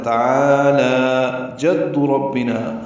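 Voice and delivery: slow emphatic تعالی; stretched taʿālā. Shown as a demonstration. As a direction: hold every long vowel in تعالی. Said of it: "برابر لمبا کرنا ہے".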